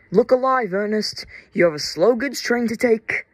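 high, punchy wake-up order; rousing call. A narrator's reading. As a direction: call out briskly to rouse a sleeper. Yard manager wakes the sleeping engine.

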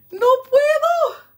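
high, loud, drawn-out whine; frustrated wail. Real, not acted. Vlogger failing to manage the bag, frustrated wail. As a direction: wail it slowly in helpless frustration.

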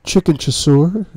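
high, hesitant question, lightly voiced housekeeper; tentative offer, unsure of his mood; uncertain housekeeper. Housekeeper unsure asks her gloomy boss about dinner, hesitant. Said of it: "her voice uncertain".

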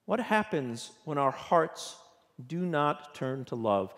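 ordinary talk, nothing marked

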